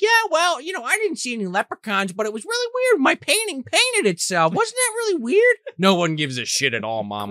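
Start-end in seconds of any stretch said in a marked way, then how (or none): 0.0-5.6 high ditzy mom voice; airy mom. Retold or imitated. Mom tells family her painting painted itself, high. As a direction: chirp it like a distracted mom. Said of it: "Mom's like".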